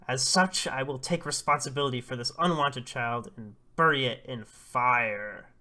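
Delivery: loud, declaiming village elder; stern proclamation. Acted. Old man Oba condemns the unwanted child, loud solemn proclamation. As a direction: proclaim the sentence with full dramatic force.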